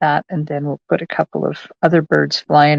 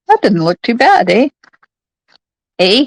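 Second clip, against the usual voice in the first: playful Canadian "eh", pitched up and louder; mock Canadian accent; 6 semitones higher, 7 dB louder than usual. Crafter jokes about sounding Canadian, mock Canadian accent. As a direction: ham up a Canadian accent on the tag "eh". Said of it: "You can tell I'm Canadian, eh?".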